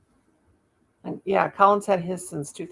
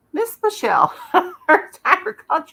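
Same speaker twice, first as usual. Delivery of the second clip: laughing greeting; 11 semitones higher, 7 dB louder than usual. Real, not acted.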